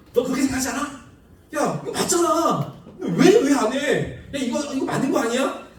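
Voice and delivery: mock-annoyed pestering, pitched well up; acted irritation. Pushing someone who disagrees to admit you are right, acted irritated. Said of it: "막 짜증을 내는 거죠".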